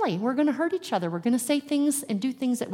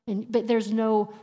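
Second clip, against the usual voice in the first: ordinary talk, nothing marked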